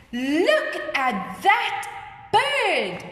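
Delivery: slow exclamation swooping high at the end; acted surprise for a class; amazed. Shown as a demonstration. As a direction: tell a friend in drawn-out wonder. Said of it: "I'm amazed, I'm surprised".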